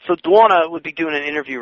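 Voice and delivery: ordinary talk, nothing marked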